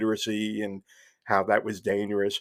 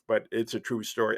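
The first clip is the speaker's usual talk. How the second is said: ordinary delivery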